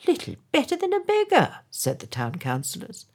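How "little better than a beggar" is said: much higher, fast, fawning echo; parroting yes-men. A narrator's reading. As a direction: squeak the mayor's words back eagerly. Councillors parrot the mayor.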